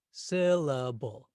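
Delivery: "Syllable" slowly, three drawn-out beats. Shown as a demonstration. Teacher counting out the beats of a word, syllable by syllable. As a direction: draw out each beat of "Syllable". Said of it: "It has three beats or three syllables".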